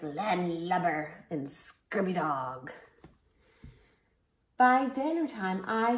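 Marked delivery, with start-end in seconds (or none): 0.0-1.3 slow, growly pirate lingo; drawn-out pirate phrase; pirate talk. Shown as a demonstration. Teaching kids pirate talk, slow pirate voice. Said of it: "say real pirate stuff like". 1.5-2.7 low, slow pirate voice; pirate talk. Shown as a demonstration. Teaching kids pirate talk, low slow pirate growl. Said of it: "say real pirate stuff like".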